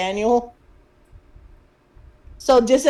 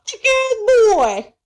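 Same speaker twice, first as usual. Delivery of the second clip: mock crying; 11 semitones higher, 4 dB louder, much slower than usual. Mock crying over big guy.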